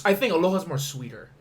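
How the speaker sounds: ordinary talk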